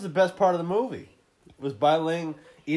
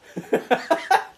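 high wheezing laugh; 13 semitones higher, 7 dB louder than usual. Real, not acted. Cracking up at own joke.

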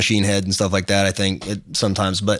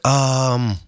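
higher, halting imitation of a French speaker; French accent; 4 semitones higher, slower than usual. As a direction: imitate a Frenchman struggling with English. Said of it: "Oh, are you from France?".

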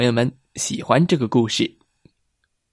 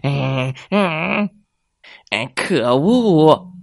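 fuming fox; 3 semitones higher, much slower than usual. Acted. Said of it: "气坏了".

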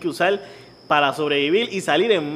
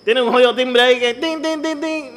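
mimicked bell; 7 semitones higher than usual.

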